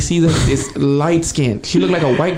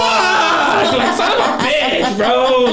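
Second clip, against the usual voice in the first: laughing dismay; 9 semitones higher, much slower than usual. Retold or imitated. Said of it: "And I'm like".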